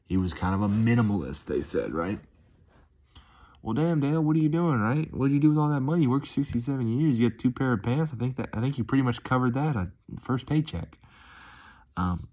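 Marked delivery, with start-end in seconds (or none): 3.6-11.0 high-pitched playful challenge; mock incredulous. Asking Dale what he does with that money, mock incredulous. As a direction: pitch it up, ribbing in disbelief.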